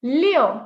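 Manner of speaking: LIU ending in an o, like "lyo"; correct LIU vowel. Shown as a demonstration. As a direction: open the end of LIU to oh. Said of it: "It's not liu".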